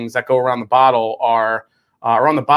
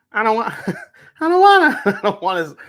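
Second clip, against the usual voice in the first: laughing dread; 7 semitones higher, faster than usual. Real, not acted. Dreading the sip.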